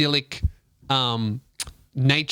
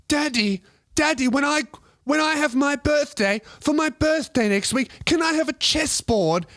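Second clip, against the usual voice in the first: put-on much higher little English boy asking his dad; English kid's voice; 12 semitones higher than usual. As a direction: play a nerdy English child begging for a chessboard. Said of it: "he's got an English accent".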